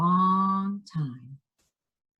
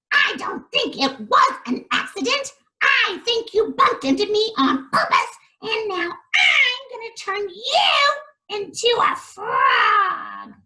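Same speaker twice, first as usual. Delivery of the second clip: high, fast, shouted witch threat; a cackling witch announcing her curse; 5 dB louder than usual. Acted. Witch threatening the prince she bumped into, shrill and loud.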